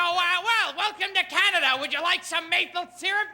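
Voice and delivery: high, bright Canadian greeter; a pushy Canadian host offering syrup. Retold or imitated. Airport greeter pushes maple syrup on a Canadian tourist. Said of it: "they go".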